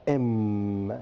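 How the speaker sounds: "M" with a long, low hum; held M sound. Shown as a demonstration. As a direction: let "M" ring on, lips closed. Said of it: "you are going to use".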